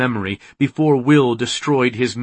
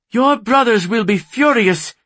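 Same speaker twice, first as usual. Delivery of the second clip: high, loud warning; indignant warning; 10 semitones higher, 5 dB louder than usual. A narrator's reading. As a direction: warn him off, high and forceful. Warning during a confrontation.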